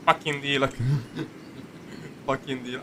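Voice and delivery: normal talking voice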